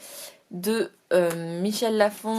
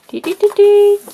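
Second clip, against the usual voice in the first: sung high fanfare to unveil; mock trumpet flourish, much higher; playful ta-da jingle; 12 semitones higher, 16 dB louder than usual. Unveiling the poster.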